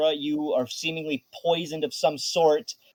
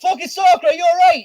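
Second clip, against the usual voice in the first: high, loud, alarmed yelling; Riz yelling out; 12 semitones higher, 7 dB louder, faster than usual. Acted. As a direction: yell out in alarm for a missing friend, pitch way up. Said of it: "He fucking starts yelling out".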